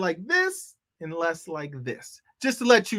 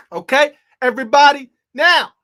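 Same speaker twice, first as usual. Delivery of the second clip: attention call; 6 semitones higher, 10 dB louder than usual. Real, not acted. Host grabs the room's attention.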